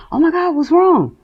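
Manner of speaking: high, concerned friend voice; worried friend. Retold or imitated. Friend on the phone asking what's wrong, high and worried. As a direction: play the alarmed friend on the phone.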